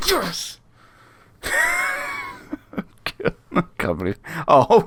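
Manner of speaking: high, laughing whoop of joy; celebrating a late goal, whooping with delight; ecstatic celebration. Real, not acted.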